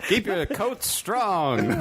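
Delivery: put-on very slow, old-timey ad announcer; 1940s voice. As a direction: drag it out like an old radio ad. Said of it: "Why were we all doing nineteen forties voice?".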